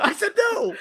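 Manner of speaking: very high-pitched imitated outburst; mock freak-out. Host echoes the guide's freak-out, mock squawk. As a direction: squawk it in hammed-up outrage. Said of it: "Freaks out".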